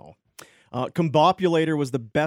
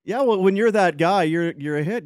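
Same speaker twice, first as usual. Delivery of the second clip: usual speaking voice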